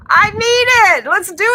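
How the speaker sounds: high, loud, eager need-it; excited. Real, not acted. Vlogger gushing over a cheap beach house, high and loud. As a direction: gush with excitement.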